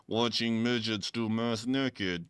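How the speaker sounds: slow deadpan answer; lightly voiced solemn nonsense; deadpan reveal. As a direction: drop it completely straight. Voicing Steven's answer.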